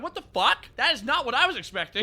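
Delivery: loud, high-pitched disbelief, retelling a reaction; shocked reaction. Retold or imitated. Acted shocked reaction to the outro.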